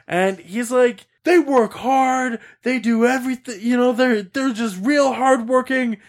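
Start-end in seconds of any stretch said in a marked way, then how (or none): 1.2-6.0 high, drawn-out mock yelling; imitating an outraged kid hollering; imitated shouting. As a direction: protest slowly in a pitched-up rant. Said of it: "he's shouting this all".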